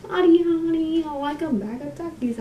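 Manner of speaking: high, playful mock-singsong; silly put-on voice. Vlogger goofing in costume, silly high voice. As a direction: pitch it up high and clown around.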